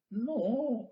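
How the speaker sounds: a feeble high "no" from the losing side; tiny high no. Pretend. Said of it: "आवाज ही नहीं आ रही sir". (पतली ऊँची धीमी आवाज़ में "नो")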